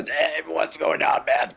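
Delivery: slow gruff throat voice; gruff throaty imitation. Mocking the host's gruff voice for a friend, slow and throaty. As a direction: push it all from the throat, slow. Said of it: "it was always gruff, but now".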